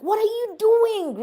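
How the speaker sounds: high, drawn-out alarmed cry. Retold or imitated. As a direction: cry it out slowly in shock as he draws the knife. Friend pulls out a knife.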